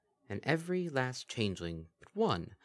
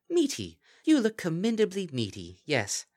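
higher and louder, relishing it; awkward compliment; 11 semitones higher, 6 dB louder than usual. Acted. The Kelpie to Lyra, gushing over meaty.

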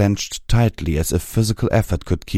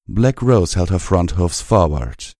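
usual speaking voice

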